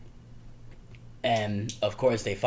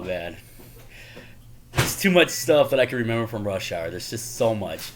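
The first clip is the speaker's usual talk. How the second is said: normal talking voice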